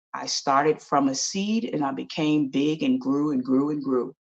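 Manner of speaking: low, soft tree voice; wise old apple tree. Acted.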